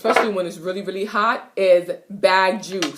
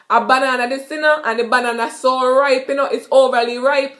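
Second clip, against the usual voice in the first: Jamaican patois, a touch higher; 4 semitones higher, 4 dB louder than usual. Shown as a demonstration. Teacher showing a patois sentence about a ripe banana. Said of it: "So I basically said".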